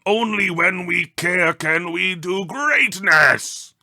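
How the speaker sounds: booming, high creature shout, drawn out; crying out. A narrator's reading. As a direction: shout it with room-quaking force, slowly. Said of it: "The visitor cried out".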